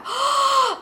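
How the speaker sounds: high shocked intake of breath; startled high gasp; sharp gasp. Retold or imitated. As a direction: gasp in sudden horror. Seeing mirror, realizing wrong room.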